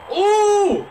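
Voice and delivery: high, loud surprised ooh; a loud startled ooh of delight; excited high whoop. Real, not acted. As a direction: react to a great play. Big play reaction.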